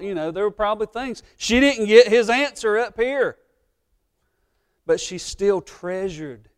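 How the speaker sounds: loud, high, driving sermon delivery; preaching at full force. Acted. Pastor preaching hard to his congregation, loud. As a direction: preach it out to the back row.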